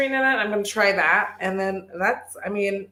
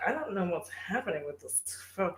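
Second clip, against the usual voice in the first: much softer, puzzled half-mutter; puzzled mumble; 14 dB quieter than usual. Retold or imitated. Busy at work, puzzled by her phone, muttered softly. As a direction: mumble it to yourself, distracted.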